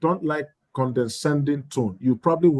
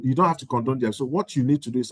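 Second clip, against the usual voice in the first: usual speaking voice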